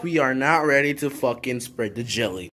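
normal talking voice

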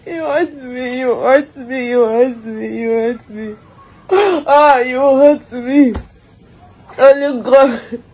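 ordinary delivery

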